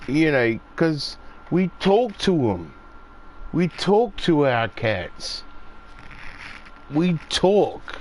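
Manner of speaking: normal talking voice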